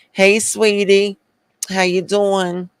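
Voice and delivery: high, drawn-out Southern greeting with a Northern tinge; high-pitched mock Southern accent, partly Northern; Southern-Northern accent mix. Shown as a demonstration. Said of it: "did a Northern accent with a Southern accent".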